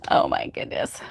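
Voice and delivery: breathy whispered aside. Real, not acted. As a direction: whisper it under your breath. Reacting to her own bad joke.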